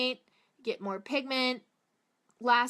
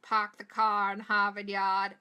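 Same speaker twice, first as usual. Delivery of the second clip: r-less Boston accent, pahk the cah; 5 dB louder than usual. Shown as a demonstration. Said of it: "That's what people like to say".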